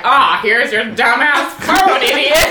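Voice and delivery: loud, slow sarcastic jab. Retold or imitated. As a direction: bite it out with sarcasm. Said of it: "like, sarcastic, like, biting comments".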